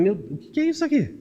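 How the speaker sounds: high, incredulous exclamation; stunned at the screaming child; astonished. Retold or imitated. Preacher retelling his shock at the parents, incredulous and high. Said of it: "olhava pros pais e falava".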